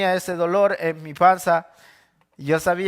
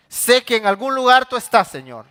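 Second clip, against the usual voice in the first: higher, louder, insistent; fervent declaration; 5 semitones higher, 5 dB louder than usual. Acted. David assuring himself of God's presence, loud and high. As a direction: proclaim it to the rafters.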